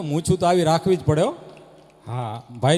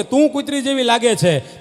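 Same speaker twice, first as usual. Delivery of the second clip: higher, louder quarrel shout; quarrel yell; 4 semitones higher, 6 dB louder than usual. Retold or imitated. Husband yelling insult at wife, loud quarrel. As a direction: hurl the insult back.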